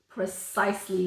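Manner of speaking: usual speaking voice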